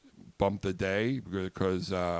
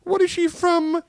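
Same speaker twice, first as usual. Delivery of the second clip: put-on very high, loud, slow falsetto old lady; 10 dB louder than usual. Host teases co-host's mother in a high slow old-lady falsetto. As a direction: croon at her like a doting old biddy. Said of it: "do Mumsy".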